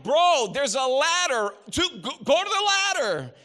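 loud, laughing call across the water. Retold or imitated. Host on the patio shouting to the kid in the lake, half laughing. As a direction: yell at the kid to head for the ladder.

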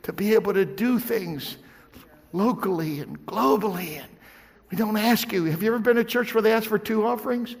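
loud high fervent preaching; preaching at full force. Acted. Pastor preaching to his church, loud and fervent. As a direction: preach it out to the congregation.

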